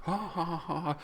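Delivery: high mock villain laugh; imitating the villain's drawn-out laughter; villain's extended laugh. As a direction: cackle like the gloating villain. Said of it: "and he starts laughing".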